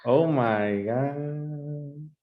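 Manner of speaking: slow, drawn-out "oh my God"; mock dismay. Teacher reacting to a student disliking rock, drawn-out mock dismay. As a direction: stretch it out in playful disbelief.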